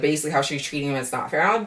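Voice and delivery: ordinary delivery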